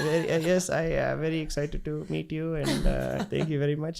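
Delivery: halting, eager greeting in a mock Indian accent; put-on Indian accent. Introducing himself to a girl as someone else, put-on Indian accent. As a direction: play a polite newcomer with an Indian accent. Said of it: "You just had the accent".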